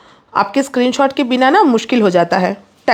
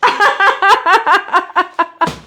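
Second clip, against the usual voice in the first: a bright, real burst of laughter; amused laugh; 6 semitones higher than usual. Real, not acted. Laughing at Rupali saying she talks too fast. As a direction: laugh at the teasing comment.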